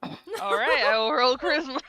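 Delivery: loud. Real, not acted. Player declares roll loudly.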